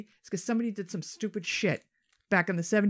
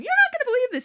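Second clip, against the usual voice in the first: much higher, louder exclaiming voice; high woman voice; 10 dB louder, faster than usual. Retold or imitated. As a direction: squeal it in disbelief. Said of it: "calling her husband".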